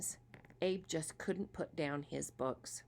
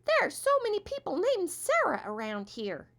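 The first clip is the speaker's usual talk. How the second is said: little squirrel voice; 13 semitones higher, 6 dB louder than usual. Acted. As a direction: play a tiny confused squirrel. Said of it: "said Abe's little friend the squirrel".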